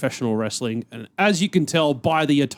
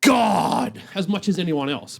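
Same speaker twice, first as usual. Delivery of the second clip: excited outburst; 10 semitones higher, slower than usual. Real, not acted. Ranting about JBL's commentary.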